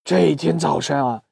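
ordinary delivery, nothing marked

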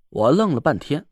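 usual speaking voice

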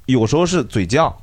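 ordinary delivery

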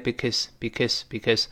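"because" quick, second vowel shrunk to schwa; weakened vowel. Shown as a demonstration. Teacher shows learners fast because, weak vowel. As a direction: clip "because" down to "bi-kuhz". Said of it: "读快之后变成because".

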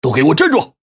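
sharp loud command; shouting. Acted. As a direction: bark the order to stop. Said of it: "大喊一声". (大喊一声)

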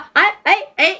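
usual speaking voice

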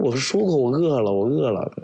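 带着哭腔提高嗓门; 哭着一遍遍说我饿了. Retold or imitated. 哭着说饿了. (哭着喊我饿了)

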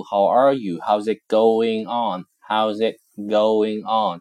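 slow, careful How's it going on; teacher modelling a greeting slowly; slow model greeting. Shown as a demonstration. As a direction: spell it out slowly for the class. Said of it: "就是跟人打招呼".

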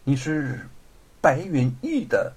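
halting, hushed disbelief with long pauses; stunned. Acted. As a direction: falter through the question, aghast. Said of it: "惊愕不已". (惊愕不已、断断续续地问)

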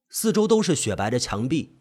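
usual speaking voice

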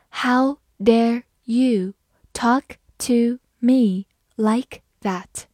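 very slow, a pause after each word; slow reading. Shown as a demonstration. Teacher demonstrating the sentence to learners, slowly word by word. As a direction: spell out the sentence word by word, unhurried. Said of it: "好，我慢慢来读".

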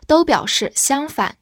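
normal talking voice

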